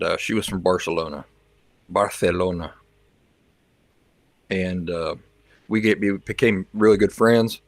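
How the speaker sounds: usual speaking voice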